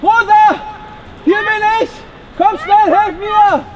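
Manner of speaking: höher, laute Hilferufe; calling for help. Acted. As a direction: verzweifelt nach Rosa rufen. Ruft aus Gefahr um Hilfe. (laute Hilferufe)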